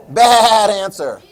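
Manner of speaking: loud, slow, laughing verdict; playful teacher rejecting a silly guess; mock scolding. Shown as a demonstration. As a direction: drag it out like a game-show buzzer. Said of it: "That was a clue".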